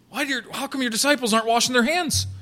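lightly mocked accuser's question; naive mock questioning. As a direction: ask it fast and high, as a petty complaint. Mock version of the Pharisees' question.

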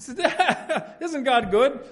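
high, delighted laugh through the words. Real, not acted. Preacher retelling the cantaloupe gift, laughing.

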